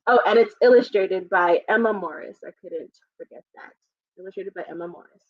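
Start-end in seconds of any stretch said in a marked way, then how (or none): 2.4-3.7 whispered aside. Real, not acted. Aside after forgetting illustrator. 4.2-5.2 quiet aside. Real, not acted. Mutters name again to self.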